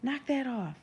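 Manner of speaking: high, commanding call; restrained, high-pitched shout; coach's shout. A narrator's reading. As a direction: order the crowd to stop. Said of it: "The Meyerson coach shouts".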